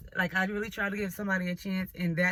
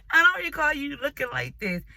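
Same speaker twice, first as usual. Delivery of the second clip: higher and louder, breaking into laughter; laughing in disbelief; 6 semitones higher, 6 dB louder than usual. Retold or imitated. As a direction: laugh the words out, unable to believe it. She confronts her ugly date.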